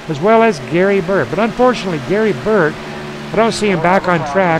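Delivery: live motorsport announcer; race play-by-play. Real, not acted. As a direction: build up to calling the caution.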